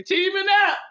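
high, loud, breaking into laughter; laughing disbelief. Real, not acted. Reader laughs at the people teaming up, laughing. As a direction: laugh it out at the absurdity. Cracks up at the schemers.

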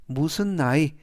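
ordinary talk

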